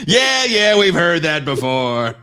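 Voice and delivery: loud, high, drawn-out brush-off; hammed-up shouted disbelief; mock dismissal. Mock interrogator brushes off the woman's protest, loudly dismissive.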